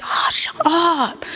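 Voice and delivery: breathy, drawn-out gasp of disbelief; whispery mock-amazed "shut up". Shown as a demonstration. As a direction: stretch it out on a breath, delightedly stunned. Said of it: "It's just like".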